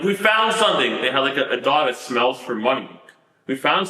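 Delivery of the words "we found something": high, excited call of a find; hammed-up triumphant yell; mock shout of discovery. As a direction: call it out like agents who found it. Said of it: "screaming, like".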